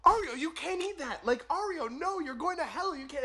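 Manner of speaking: frantic mother. Retold or imitated. Mother panics over pork.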